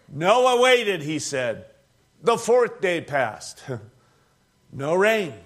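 ordinary talk

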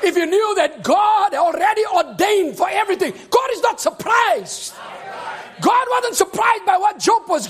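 loud, high, slow preacher's shout; preaching. Acted. Preacher shouting assurance to the church. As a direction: proclaim it to the congregation.

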